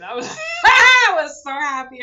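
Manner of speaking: high, loud, laughing shout of delight; thrilled laughing cry; laughing with joy. Real, not acted. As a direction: burst out laughing with happiness. Delighted by mother's praise.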